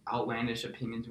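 ordinary talk, nothing marked

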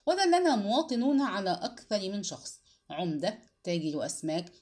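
ordinary delivery

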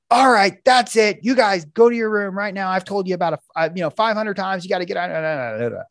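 a hammed-up parent losing it; parent snapping. Parent snaps at kids to go to their room, mock angry. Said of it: "mom or dad, snap".

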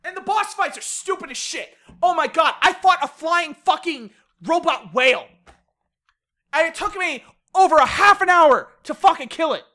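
loud, high-pitched exasperated rant; incredulous, heated complaining; really worked up. Real, not acted. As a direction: vent real frustration, punching the swear words. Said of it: "I'm getting really worked up".

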